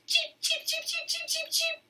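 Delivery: very high tiny cheeping; baby chicks. Acted. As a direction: peep like newborn chicks.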